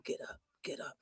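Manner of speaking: silent mouthing, barely any voice; mouthed. Retold or imitated. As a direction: mouth it without sound. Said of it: "he was mouthing to him".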